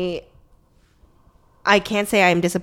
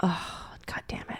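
whispered, disappointed; soft let-down curse; 14 dB quieter than usual. Real, not acted. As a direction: breathe the curse out under your breath. Disappointed aside.

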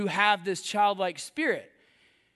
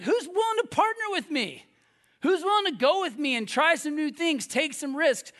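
eager invitation; 7 semitones higher than usual. Acted. Voices God inviting partners.

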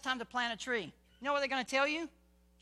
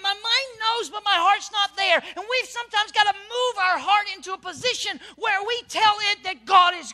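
high, loud fervent preaching; full-force preaching; 9 semitones higher, 11 dB louder than usual. Real, not acted. Preacher urging the congregation to preach to themselves, fervent. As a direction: preach it to yourself with fire.

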